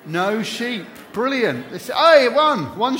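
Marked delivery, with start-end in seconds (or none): 1.7-3.0 excited exclamation. Real, not acted. Spots the first sheep.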